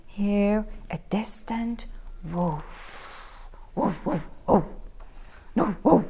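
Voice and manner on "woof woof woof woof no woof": low, deep barking; dog barking. A narrator's reading. Distant dog barking at the cats.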